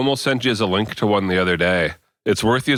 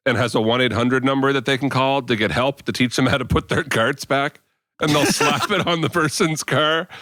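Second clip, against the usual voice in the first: ordinary talk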